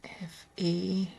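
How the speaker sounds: barely breathed, tracking the letters; whispered letters. Real, not acted. Spelling on the letter board, whispering letters to herself.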